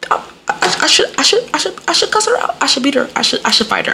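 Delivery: high stammering mock-tearful voice; squeaky, stuck-on-repeat whimpering, easing down; almost crying. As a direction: re-enact sputtering through frustrated tears. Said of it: "I almost started to cry".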